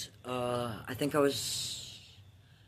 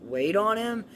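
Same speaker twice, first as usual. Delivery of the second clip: put-on higher, brighter helpful kid voice; eager child; 6 semitones higher, 5 dB louder than usual. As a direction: chirp it out to be helpful. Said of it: "I was like".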